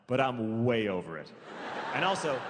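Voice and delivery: ordinary talk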